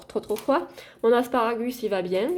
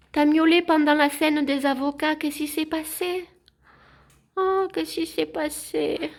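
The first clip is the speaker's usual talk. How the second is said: high, cooing questions to a cat; doting sing-song pet voice, pitched up; pet talk; 5 semitones higher than usual. Real, not acted. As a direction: fuss over a cat that meowed. Asking her cat why it meowed.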